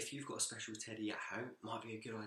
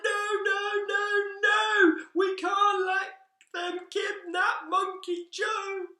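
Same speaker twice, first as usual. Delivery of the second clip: much higher, louder sing-song shout of no, slowing at the end; animals chorus shouting; 16 dB louder, much slower than usual. Acted. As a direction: cry out like a crowd of animals refusing. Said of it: "all of the animals said".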